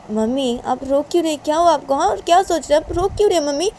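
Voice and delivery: high, loud, urgent questioning; worried girl pleading for an answer; concerned daughter. Acted. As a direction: ask your crying mother urgently, pitch climbing.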